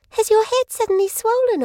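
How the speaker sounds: put-on cheeky little girl. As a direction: tease him with a straight face. Child voiced in the story.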